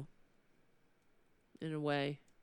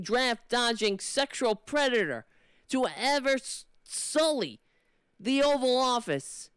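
indignant rant, pushed up in pitch; heated, emphatic political scolding; impassioned tirade; 3 semitones higher than usual. Acted. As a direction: lecture a politician with rising exasperation. Rehearsed tirade at Democratic representatives.

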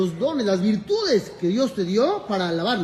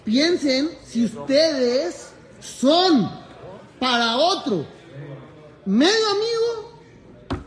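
slow emphatic; 3 semitones higher, much slower than usual. Real, not acted. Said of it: "pausa enfática ante la audiencia".

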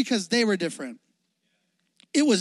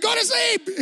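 mock yelling parent; 13 semitones higher than usual. Dad yelling at baby Dottie, hammed-up. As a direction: snap at the baby jokingly.

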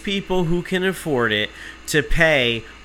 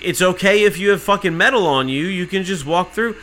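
higher, louder incredulous mimicry; mock permissive agent; 6 semitones higher, 4 dB louder than usual. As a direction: mimic an absurdly permissive guard. Mimicking lax security staff.

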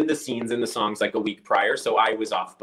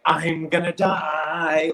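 exhausted groan; 5 semitones higher, much slower than usual. Retold or imitated. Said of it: "My brain is dead".

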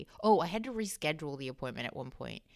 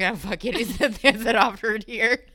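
laughing; 4 semitones higher, 8 dB louder than usual. Retold or imitated. Laughing at own cheeky request.

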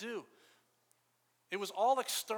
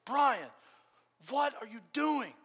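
louder and higher, calling out his name; loud mom yell; 7 semitones higher, 6 dB louder, slower than usual. Retold or imitated. Mother shouting at her son in his bedroom. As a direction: mom catching her kid drawing on the wall. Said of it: "in a very loud voice".

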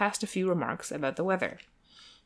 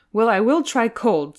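slow, louder, deliberately manly; manly resolve; 4 semitones higher, 6 dB louder than usual. Acted. Mister Saltina to the valet, slow and manful. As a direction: draw it out to sound brave.

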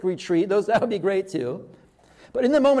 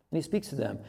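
softer echo; 5 semitones lower, 7 dB quieter than usual. Shown as a demonstration. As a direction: repeat "And he speaks to them" softer, lower.

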